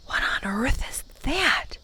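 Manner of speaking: whispered, breathless question; boy whispering in alarm; hushed whisper. A narrator's reading. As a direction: whisper it, uneasy at the eerie noise. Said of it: "spoke in a hushed voice".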